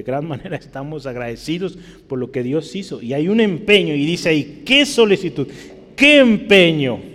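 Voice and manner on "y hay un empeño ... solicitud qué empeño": full-force preaching. Acted. Preacher calling out each result to the congregation, preaching hard. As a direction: thunder out every question to the pews.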